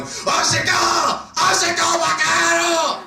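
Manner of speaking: high, slow, drawn-out yells; imitated Japanese man shouting. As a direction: act a Japanese man's involuntary outburst. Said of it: "this time the guy was Japanese".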